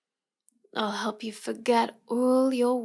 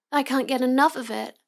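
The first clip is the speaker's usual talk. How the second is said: normal talking voice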